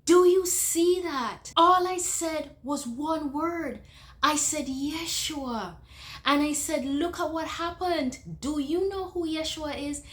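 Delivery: high, loud, amazed insistence; urgent amazement. Retold or imitated. Holding the old lady by her shoulders, amazed and urgent.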